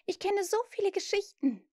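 high, tempting plea; pleading. A narrator's reading. Wendy offers stories so Peter stays, pleading. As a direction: coax him imploringly, pitch high. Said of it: "flehte sie ihn an".

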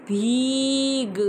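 भीग drawn out, long ee held; slow careful word. Shown as a demonstration. Teacher modelling the word slowly for pupils. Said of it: "ma'am ने भ के circle पर".

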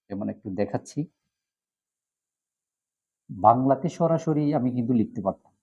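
usual speaking voice

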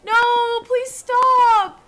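high, loud apology shouted after the horse; pleading yell at the fleeing horse; frantic pleading. Real, not acted. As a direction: beg the horse to come back. Chasing horse.

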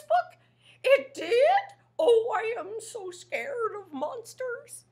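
high, alarmed monster voice rising into a wail; frightened puppet squeal; scared Grover. Acted. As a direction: panic at the word monster. Grover reads the warning.

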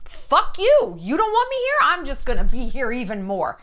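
hammed-up, imitated indignation, pitch leaping up; mock defiance. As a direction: throw it out like a spiteful retort. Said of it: "And this is kind of her".

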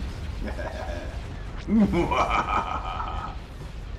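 long, drawn-out evil laugh; hammed-up villain laugh. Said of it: "Had my evil laugh".

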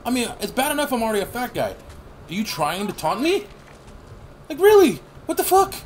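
high, indignant rant at food photos; mock outrage. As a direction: act out being taunted by food pictures, high and exasperated. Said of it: "I'm just sitting there going".

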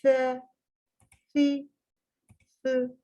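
"F, fi, fı" with tongue out, lisped. Shown as a demonstration. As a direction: put the tongue between the teeth for "F, fi, fı". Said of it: "Dil dışarıya peltek". (dil dışarıda peltek "F, fi, fı")

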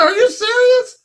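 high squeaky woman's voice; a startled falsetto question; retold disbelief in a high voice; mock surprise. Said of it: "She said".

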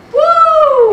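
long, very high, loud whoop; exultant whoop. Real, not acted. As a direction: let out a sustained whoop of praise. Preacher whoops at being God's heir.

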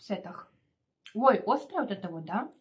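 talking mid-bite; chewing while speaking; mouth full. Real, not acted. Seen 0: Sushi piece at lips during the words; speaker uncertain between two.

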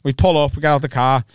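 ordinary talk

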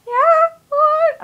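very high, loud squeaky reply; anxious. Retold or imitated. Kid called over by counter guy, anxious. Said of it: "anxious, and I was like".

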